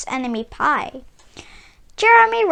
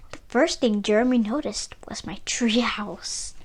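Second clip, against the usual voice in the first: whispering; 6 semitones lower, 8 dB quieter than usual. A narrator's reading. Secret discovery in story.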